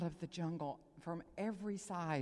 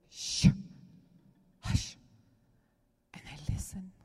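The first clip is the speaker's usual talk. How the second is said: heaven hushing; 5 dB louder than usual. Retold or imitated. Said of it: "all of heaven goes".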